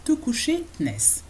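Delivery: normal talking voice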